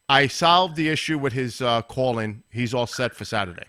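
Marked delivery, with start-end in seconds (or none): none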